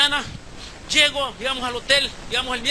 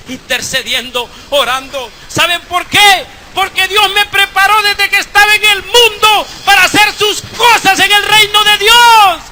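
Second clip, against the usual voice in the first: much louder and higher, a preacher's cry; shouted preaching; 9 semitones higher, 15 dB louder than usual. Acted. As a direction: ring it out over the congregation.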